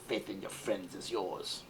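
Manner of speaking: slow sinister Emperor voice; Palpatine voice. Pretend. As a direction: play the evil Emperor, slow. Toy figure line in Palpatine voice.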